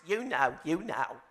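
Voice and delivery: light imitation of a sitcom nanny; Fran Drescher voice. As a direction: quote her famous line in her voice. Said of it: "doing her lines".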